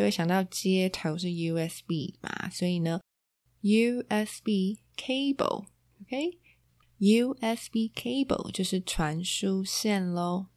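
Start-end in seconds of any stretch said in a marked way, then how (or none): 3.6-8.5 slow, clear USB cable; slow model pronunciation. Shown as a demonstration. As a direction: draw out USB cable slowly. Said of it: "slow model pronunciation".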